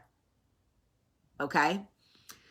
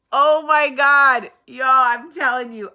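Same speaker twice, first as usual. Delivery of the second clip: loud, high, drawn-out exclamation; amused outburst; 6 semitones higher, 10 dB louder, slower than usual. Real, not acted. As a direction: exclaim it big, tickled by your own story.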